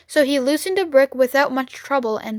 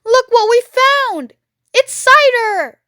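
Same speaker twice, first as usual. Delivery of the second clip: put-on excited child; 11 semitones higher, 6 dB louder than usual. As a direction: burst with a kid's delight. Young fox finds cider.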